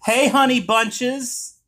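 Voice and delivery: high, slow sweet hello; drawn-out loving greeting; affectionate greeting. Real, not acted.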